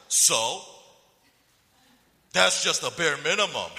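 loud, scornful imitation; entitled dismissal. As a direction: play the unimpressed, ungrateful son. Friend dismisses expensive gift, acted.

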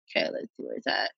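fast whisper while scrolling the phone; whispered to self. Real, not acted. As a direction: breathe it to yourself while searching. Muttering to herself while finding text.

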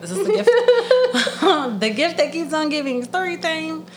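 high laughing squeal. Real, not acted. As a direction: laugh through the words. Bursting into laughter.

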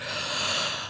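sharp whispered gasp of panic; panicked gasp. Retold or imitated. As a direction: gasp in sudden alarm. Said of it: "but I was like".

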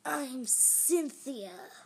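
slow, loud, raspy drawn-out voice; rasping monster. A narrator's reading. Second monster introduces herself, rasping. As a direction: rasp it out slowly. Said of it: "the second monster rasped".

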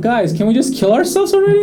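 high, slow mock despair at the gloomy crowd; mock exasperation. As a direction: whine it out, hamming the exasperation. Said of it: "'cause I was like".

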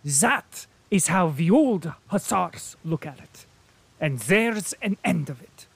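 fervent German colonel. A narrator's reading. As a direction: declare it while thumping the table. Colonel addresses Nicholas.

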